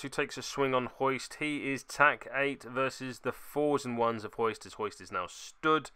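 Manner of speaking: usual speaking voice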